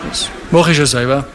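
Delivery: a hint of a reluctant young man. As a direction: brush the question off, mock-evasive. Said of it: "Dann sagt er".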